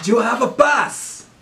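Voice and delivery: put-on high, loud, slowed German-accented demand. Guard demanding a pass, German accent shout. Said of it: "more of a Germanic voice".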